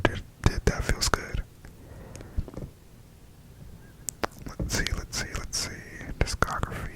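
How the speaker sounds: hushed whisper throughout, barely voiced. Fan whispering her album ranking to the camera, whispered. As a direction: keep it all in a soft breathy-free whisper.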